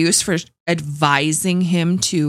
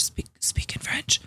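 urgent whispered coaching; 10 dB quieter than usual. Retold or imitated. A host mimics the councillor whispering to him. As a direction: whisper it fast like a secret prompt. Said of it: "she's like".

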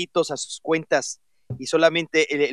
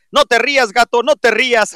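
loud teasing read; 7 semitones higher, 8 dB louder than usual. Acted. Reading out a teasing tweet.

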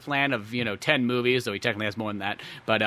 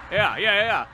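eager mobster yes; 6 semitones higher, 8 dB louder than usual. Retold or imitated. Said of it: "mobsters answer eagerly".

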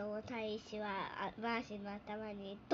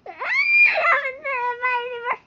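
loud and high, squealing while tickled; being tickled; 13 semitones higher, 17 dB louder than usual. Real, not acted. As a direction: shriek and give up between laughs. Child tickled until giving up.

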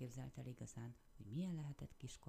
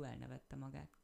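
usual speaking voice

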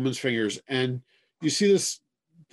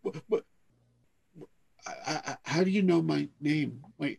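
stunned stammer; 7 dB quieter, faster than usual. Acted. Stunned kid is called by name.

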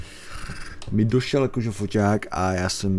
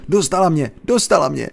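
high, loud panicked wail; girl screams the witch has got her; terrified cry; 9 semitones higher, 5 dB louder than usual. Acted. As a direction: cry out in terror. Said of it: "volala s pláčem".

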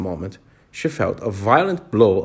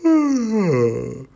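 caterpillar yawn; 8 semitones higher than usual. A narrator's reading. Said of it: "yawned once or twice".

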